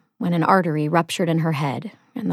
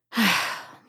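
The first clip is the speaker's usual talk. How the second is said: soft weary exhale; sigh; 4 semitones higher, 5 dB quieter than usual. Acted. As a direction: let out a tired sigh before asking. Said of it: "She sighs".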